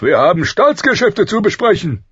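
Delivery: high, booming royal shout; loud summons. A narrator's reading. King rousing his friend for state business, loud summons. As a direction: boom it out like an impatient king. King rouses Ned from sleep.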